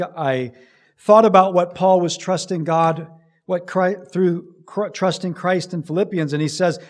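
normal talking voice

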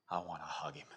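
low, very soft confession; tender longing. Real, not acted. Preacher longing to meet Jesus in heaven, tender. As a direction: confide it quietly, from the heart.

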